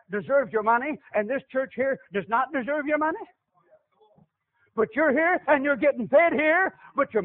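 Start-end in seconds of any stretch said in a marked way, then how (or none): none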